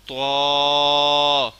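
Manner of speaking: طا heavy with a full mouth. Shown as a demonstration. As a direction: fill the mouth for طا. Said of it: "منہ کو پر کر کے پڑھنا ہے".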